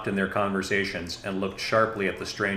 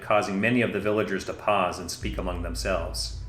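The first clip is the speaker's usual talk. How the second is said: usual speaking voice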